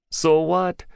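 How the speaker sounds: high, bitter retort; bitter young man scoffing. Acted. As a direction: spit it out bitterly. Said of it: "Bidar said bitterly".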